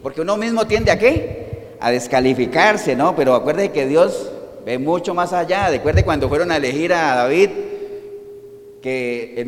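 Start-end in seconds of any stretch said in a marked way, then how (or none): none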